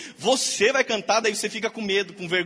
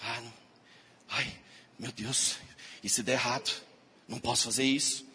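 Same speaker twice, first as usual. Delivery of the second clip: low, much softer, scared little voice; fearful doubting whine; 9 semitones lower, 10 dB quieter than usual. Retold or imitated. A believer watering the seed of fear, softly whimpering. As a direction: sound defeated and afraid before even trying. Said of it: "rega a sementinha de medo dele e fala".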